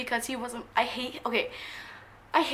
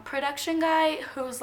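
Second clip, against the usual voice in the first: ordinary talk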